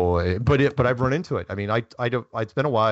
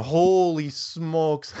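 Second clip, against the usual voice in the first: drawn-out stunned exclamation, higher; slow amazed holy smokes; 5 semitones higher, much slower than usual. Retold or imitated. Telling the hosts how he reacted to the agent, slow and amazed. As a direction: let the amazement stretch out slowly.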